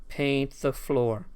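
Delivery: slow, drawn-out paint the floor; slow drawn-out command. Pretend. Streamer echoing the Karate Kid line, drawn out slow. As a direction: stretch each word out slowly.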